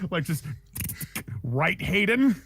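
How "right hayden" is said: mock threat. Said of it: "Like how you say this like it's a threat".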